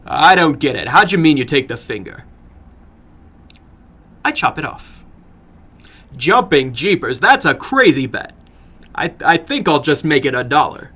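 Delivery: put-on a touch of a protesting boy; eager young American. Narrator voices the boy.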